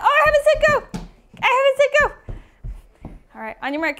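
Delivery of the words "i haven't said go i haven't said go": high, loud false-start protest; playful calling out. Real, not acted. Teacher catches children starting before go, calling out. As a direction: halt the runners with a raised voice.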